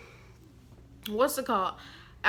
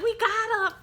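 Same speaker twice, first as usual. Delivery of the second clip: very high, louder gloating; mock gleeful squeal; 13 semitones higher, 5 dB louder than usual. Pranksters after hitting the boy, high mock glee.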